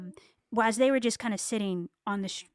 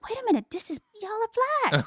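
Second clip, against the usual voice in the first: high, loud double take; mock astonishment, rising higher and louder; retold surprise; 14 semitones higher, 6 dB louder than usual. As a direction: act out a sudden realization. Acting out photographer's sudden realization.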